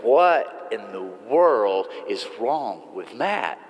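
a slow, low, mock-baffled aside, leaning on "What"; dismissive head-shake as others gossip about Matt; puzzled gossip. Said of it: "we hear that and we go".

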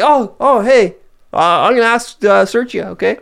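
hammed-up high cop voice; apologetic cop. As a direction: act the awkward policeman about to frisk. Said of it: "He's like".

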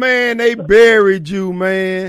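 loud, slow, jokingly amazed; mock shock. Real, not acted. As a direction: act like you've seen a ghost. Host teases long-lost caller.

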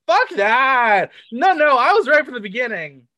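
loud, fed-up retold thought; exasperated outburst. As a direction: blurt it out, fed up. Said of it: "I was like".

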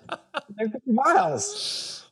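drawn out and high through laughter; telling a friend about his walks, laughing. Real, not acted. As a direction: stretch it out slowly, laughing. Laughing at his own boast.

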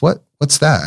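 a hint of a curious four-year-old; little niece asking. Niece asking about the weeping Buddha, lightly childlike. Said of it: "and she says".

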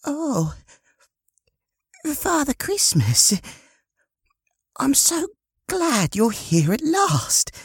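very high, delighted woman's voice; smiling grey-haired woman. Acted. Grey-haired woman welcoming Father Christmas, high and delighted. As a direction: greet a long-awaited guest with high-pitched delight. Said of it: "a smiling grey-haired woman".

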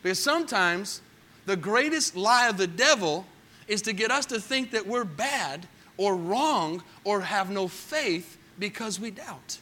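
normal talking voice

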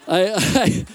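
cracking up at own failed accent; real helpless giggles; laughing. Real, not acted. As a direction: break into laughter trying to speak.

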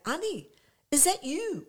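voiced as a surprised woman; surprised greeting. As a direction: call out to a friend you just recognised. Retelling a surprised greeting from behind.

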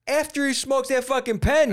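high, hammed-up cocky voice; cocky acceptance. Answering Rogan's challenge on air, cocky and high. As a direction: accept the dare like a showman.

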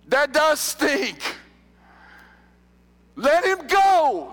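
high, loud fiery preaching; preaching at full force. Acted. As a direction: preach it out with passion.

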